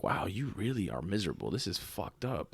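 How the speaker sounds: low, soft aside under the breath; quietly appalled, as if thinking it to yourself; muttered aside. Retold or imitated. Muttering privately about a rude cashier, quiet disbelief. Retells his inward reaction at register.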